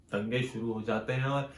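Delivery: ordinary delivery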